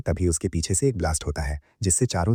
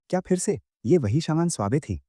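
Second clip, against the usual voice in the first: lightly voiced surprised question, much higher pitch; mild surprise; 9 semitones higher than usual. A narrator's reading. Classmate reacting to another duel request, mildly surprised.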